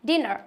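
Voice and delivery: dinner said with a short i; model word dinner. Shown as a demonstration. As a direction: clear classroom model of dinner, short vowel. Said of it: "For example".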